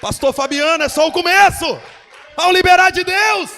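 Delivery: high, loud preacher's cry; shouted proclamation. Acted. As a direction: keep the shout going over the music. (proclamação gritada)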